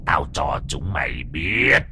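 low, slow, ghostly threat; possessed menace. Acted. Possessed Lan warns the tenants, low slow ghostly threat. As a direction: let the spirit speak through her.

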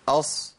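أص cut at natural length; brief whistled s ending أص; زمن طبيعي. Shown as a demonstration. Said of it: "ما تزيدش عن كده". (نطق أص بصاد غير ممدودة)

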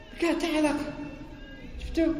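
high, soft mocking squeak; high mocking aside to the audience. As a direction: squeak it out in mock disbelief. Mocking the unrelated word, high.

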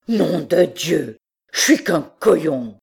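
crié fort et lent; cri. Acted. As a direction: hurle ta colère contre toi-même, lentement. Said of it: "cria-t-il". (il jette sa casquette et crie fort, lentement)

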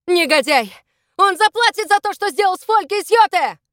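loud, high-pitched vow of revenge; angry shout. A narrator's reading. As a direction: shout the threat in outrage. Angry threat during a chase.

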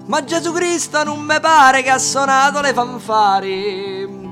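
usual speaking voice

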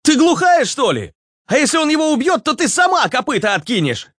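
high-pitched angry scolding; barking. A narrator's reading. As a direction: snap at her in a very high voice. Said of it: "Рявкнул Руслан". (высоко и раздражённо рявкать)

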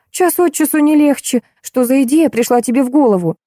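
high-pitched indignant questioning; exasperated scolding. A narrator's reading. Julia reproaching her relative for the idea, exasperated.

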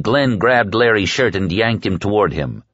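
plain reading voice

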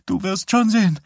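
high-pitched crying voice; tearful. A narrator's reading. Defeated wife warning her husband, tearful. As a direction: say it through tears. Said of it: "sagte sie unter Tränen".

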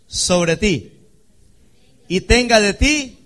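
loud, high preaching cry; preaching at full force. Acted. Preacher to congregation, loud fervent blessing. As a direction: proclaim the blessing over the congregation.

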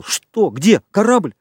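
high, urgent burst of questions; jolted, pitch leaping up; startled questions. A narrator's reading. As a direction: fire them off in startled alarm. Startled reply to sudden yell.

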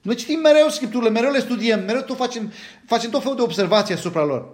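high, boastful mock insistence; pompous Pharisee boast; self-righteous Pharisees. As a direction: brag like a proud Pharisee. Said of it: "Ar fi putut spune fariseii".

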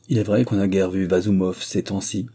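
ordinary delivery

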